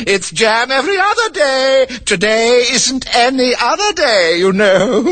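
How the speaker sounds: put-on high, fluttery old queen; high, slow voice of a muddled queen. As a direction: play the dithering Queen, pitched high.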